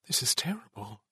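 softly voiced dismay; inner thought. A narrator's reading. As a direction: think it aloud, quietly. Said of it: "Duncan thought".

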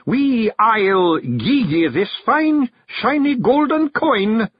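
high, loud Scots brogue, slowly drawn out in places; bearded mystery stranger. A narrator's reading. Stranger in the beard haggling with the carter for a lift, high insistent Scots. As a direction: put on an excitable high Scottish voice.

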